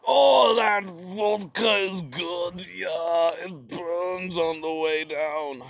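slow, hoarse peasant savoring vodka; a hint of a drunk peasant, drawn out; mock Russian peasant. Narrator voicing Russian people enjoying vodka, slow and rough. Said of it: "The Russian people were just like".

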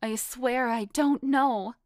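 plead, drawing the words out. A narrator's reading. Foxy pleading with Frank for Madoff's life, pleading. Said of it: "I pleaded".

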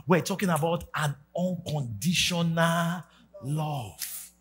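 ordinary delivery

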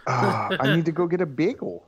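self-mocking Pennsylvania accent. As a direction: send up his own Pennsylvania accent. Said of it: "the heavy Pennsylvania accent".

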